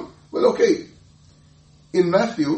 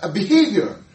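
ordinary delivery

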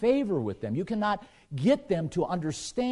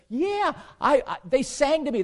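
excited; 9 semitones higher, 4 dB louder than usual. Retold or imitated. Jose excitedly tells his friends.

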